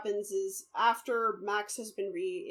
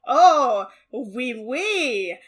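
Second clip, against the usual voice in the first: hammed-up fake French accent, louder; mock French accent; 2 semitones higher, 8 dB louder than usual. Mocking the actor's awful French accent. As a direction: ham up a cheesy French inspector. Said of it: "no one in the history of France has ever spoken like that".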